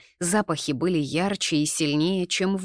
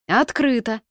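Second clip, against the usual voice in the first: higher, louder shout from inside; brief raised-voice holler; called out; 6 semitones higher, 5 dB louder than usual. A narrator's reading. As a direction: call out through the door. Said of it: "закричали изнутри".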